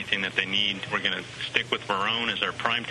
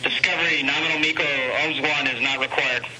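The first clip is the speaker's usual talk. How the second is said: raised, higher voice for the crew; loud radio call; 5 semitones higher, 5 dB louder than usual. Real, not acted. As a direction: project it over the radio link. Calling the shuttle crew by radio.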